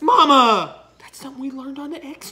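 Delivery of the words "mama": loud reproachful Mama; scolding shout. Real, not acted. Scolding mom over necklace.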